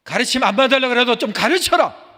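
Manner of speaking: loud and high insistent; emphatic command. Acted. Preacher commanding parents to teach, loud. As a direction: drive the command home. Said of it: "emphatic loud command".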